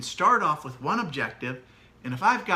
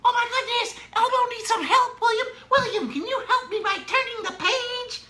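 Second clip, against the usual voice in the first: silly Elmo voice; 4 dB louder than usual. Shown as a demonstration. Parent reading to William as Elmo, very high silly voice. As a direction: play Elmo for a toddler, way up high. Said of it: "I'd read down this with my silly voice".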